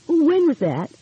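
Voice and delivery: a hint of eager young woman. Nancy's eager question.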